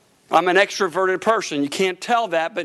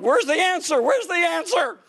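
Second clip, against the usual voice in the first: high, pleading cry for an answer; desperate plea; 8 semitones higher than usual. Retold or imitated. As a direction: beg for the answer. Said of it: "I was like".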